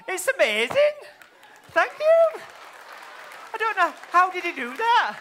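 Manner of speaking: high, loud astonished cries; stage amazement. Acted. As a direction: marvel loudly at a magic trick. Amazed at vanished balloon.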